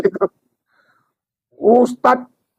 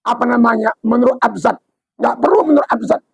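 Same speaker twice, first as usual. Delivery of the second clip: normal talking voice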